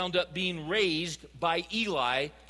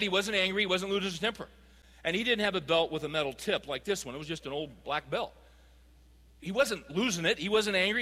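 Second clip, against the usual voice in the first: ordinary talk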